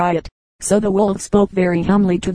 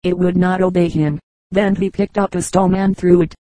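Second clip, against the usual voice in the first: ordinary delivery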